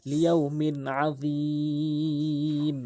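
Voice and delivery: slow, drawn-out melodic recitation; Quran recitation. Acted. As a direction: chant the verse slowly. Recites the verse.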